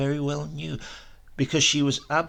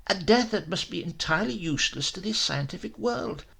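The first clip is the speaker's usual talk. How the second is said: a lightly voiced, raised-pitch scientist pleading his cause; obsessed professor; 8 semitones higher than usual. Professor to Giuseppe about his experiment, lightly voiced fervour. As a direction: let the professor's fervour lift the voice a little.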